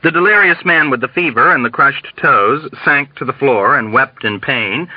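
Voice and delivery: plain reading voice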